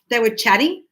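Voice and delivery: ordinary delivery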